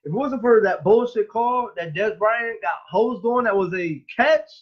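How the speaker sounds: heated complaint. Real, not acted. Heated argument over a bad call.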